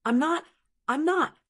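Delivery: high, bitter little denial; bitter laughing denial. Acted. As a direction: laugh it off with a wry smile. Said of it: "smiled bitterly".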